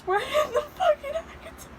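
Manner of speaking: real high-pitched crying voice; tearful. Real, not acted. Reader realizing the crossover is real, tearful. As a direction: voice climbing into tears. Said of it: "I'm genuinely trying not to cry".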